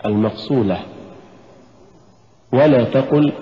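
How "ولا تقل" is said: ordinary talk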